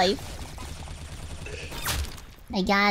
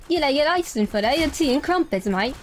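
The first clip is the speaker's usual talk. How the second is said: mock British accent; 2 semitones higher than usual. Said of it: "British be like".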